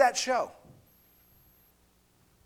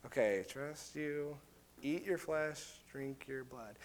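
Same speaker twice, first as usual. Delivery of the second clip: hesitant mock agreement; 5 semitones lower, 14 dB quieter than usual. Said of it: "their response is like".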